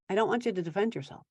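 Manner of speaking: usual speaking voice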